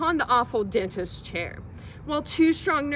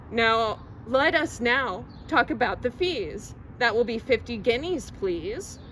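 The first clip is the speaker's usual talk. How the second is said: plain reading voice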